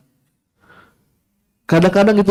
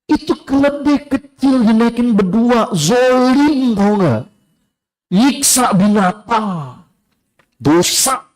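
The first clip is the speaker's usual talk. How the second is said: lightly voiced nosy netizen, higher and scolding; mocking netizen; 7 semitones higher, slower than usual. Preacher imitating a judging netizen, high and scornful. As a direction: play a self-righteous netizen tutting at the father.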